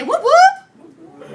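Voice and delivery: high, loud whoop cheer to rally the class; leading a classroom cheer, loud and high. Shown as a demonstration. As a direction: shout it up high like a hype call. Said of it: "Everybody say".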